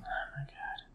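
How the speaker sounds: breathless whisper of excitement; whispered awe. Real, not acted. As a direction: whisper it like you can't contain yourself. Excited about the match.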